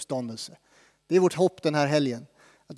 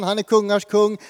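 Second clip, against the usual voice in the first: ordinary delivery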